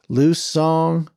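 "Liu Song" said high and carefully; careful name attempt. A narrator's reading. Sheriff trying to get the name right, careful "Liu Song". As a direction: sound out "Liu Song" deliberately, as an unfamiliar name. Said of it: "sincerely trying to get his name right".

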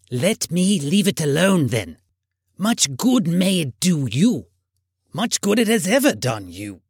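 put-on sour, sarcastic old miser; emphatic grumpy uncle's retort; crotchety Scrooge. A narrator's reading. Scrooge snaps back at his nephew.

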